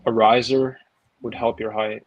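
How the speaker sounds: ordinary talk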